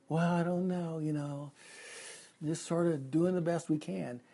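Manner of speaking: soft, noncommittal mock shrug; unsure. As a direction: imitate someone vaguely muddling along. Mimics people guessing through life.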